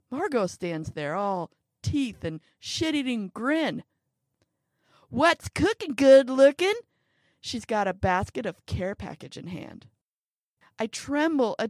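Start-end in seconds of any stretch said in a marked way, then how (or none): 5.1-6.7 loud cheery greeting. A narrator's reading. Margot greeting loudly.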